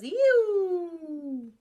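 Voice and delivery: loud, high whoosh; jet roar. Shown as a demonstration. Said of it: "A jet goes".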